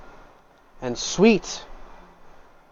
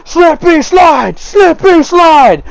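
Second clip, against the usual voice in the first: much higher, louder and slower chant, hammed-up; rowdy cheering patrons; 8 dB louder, slower than usual. Tavern patrons cheer the sliding stranger, high loud chant. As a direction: cheer it like a delighted drunk crowd. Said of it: "are just like".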